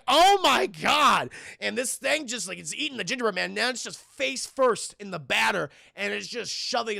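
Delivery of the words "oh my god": loud, drawn-out mock horror; slow, raised cry of shock; imitated horrified reaction; acted shock. Acted mother's disgusted shock.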